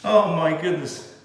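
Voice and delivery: ordinary talk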